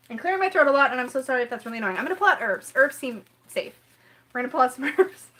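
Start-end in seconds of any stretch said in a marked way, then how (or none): none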